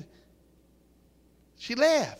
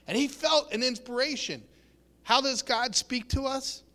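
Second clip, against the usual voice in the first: ordinary talk, nothing marked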